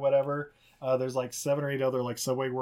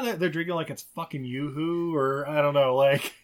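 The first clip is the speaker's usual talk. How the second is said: ordinary talk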